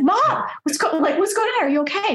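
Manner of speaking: high, panicky retold questions; high-pitched mock alarm; alarmed questioning. Alarmed child finds bomb-like bathroom.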